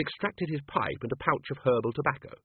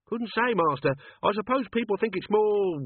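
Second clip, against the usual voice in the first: much higher, nervous servant; old servant voice; 10 semitones higher, 3 dB louder than usual. Acted. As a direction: squirm like a guilty old servant. Albert answering nervously.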